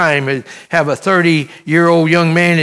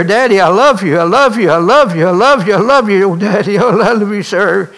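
loud, pleading cries into his ear; frantic loud pleas, breaking into a sob-laugh; screaming; 4 semitones higher, 4 dB louder than usual. Retold or imitated. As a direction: shout it, begging him to hear. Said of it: "screaming into his ear".

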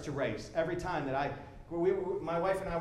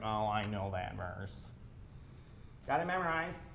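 jaded shrug; 11 semitones lower, 9 dB quieter than usual. Retold or imitated. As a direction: sound like you've heard it a thousand times. Jaded believer shrugs off verse.

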